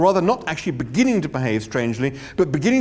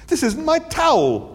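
high, puzzled complaint; baffled whine; 12 semitones higher, 4 dB louder than usual. Acted.

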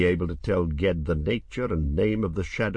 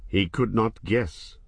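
ordinary delivery, nothing marked

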